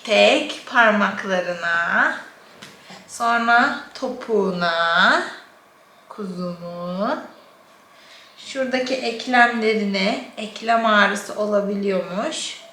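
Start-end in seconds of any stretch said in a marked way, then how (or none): 6.1-7.2 soft, slow cooing. Real, not acted. Mother cooing to baby.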